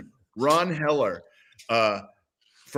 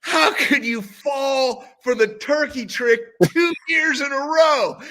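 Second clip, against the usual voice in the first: slow, higher jeering question; mocking teammates; 12 semitones higher, 4 dB louder, slower than usual. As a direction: tease him for being fooled. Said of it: "I hear these guys like".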